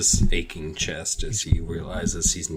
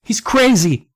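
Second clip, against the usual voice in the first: high, loud alarmed outburst; crying out; 8 dB louder, slower than usual. A narrator's reading. Louisa warning her friend, crying out. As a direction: cry it out at a much higher pitch. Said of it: "Louisa cried to me".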